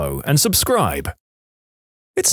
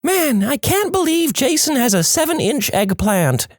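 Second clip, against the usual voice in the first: much higher, hammed-up fake girl voice; mock a girl badly into the mic; over-the-top falsetto girl impression; terrible girl voice. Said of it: "in the worst girl voice you can imagine".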